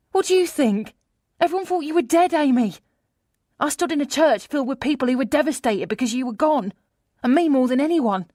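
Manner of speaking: high, loud, aggrieved friend; acted reproach from a devastated best friend; upset friend. A narrator's reading. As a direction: let the hurt spill out, pitched high and loud.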